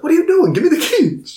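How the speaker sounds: high-pitched exasperated retold order; mock angry demand. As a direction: pitch it way up, acting out a quarrel. Retold quarrel over car keys.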